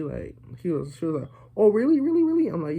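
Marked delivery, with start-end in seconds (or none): 1.6-2.5 put-on high, loud girlish "really, really?"; squeaky mock-girl voice, much higher and louder; mock surprised girl. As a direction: ham up her delighted disbelief. Girl's excited reply, retold.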